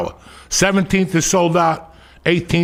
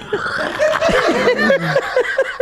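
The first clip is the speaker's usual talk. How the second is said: high burst of laughter. Real, not acted. Laughs at vegan joke.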